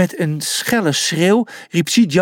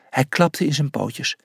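usual speaking voice

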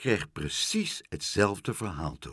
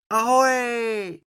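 high, loud call out into the sky; loud seagull cry; 14 semitones higher, 5 dB louder than usual. Acted. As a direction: shout it as you fly away. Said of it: "met een harde schreeuw".

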